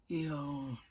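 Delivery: low, drawn-out "yawn", softly; suggestive yawn word. Retold or imitated. Teasing a susceptible coworker into yawning, low drawn-out word. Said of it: "all you had to go was".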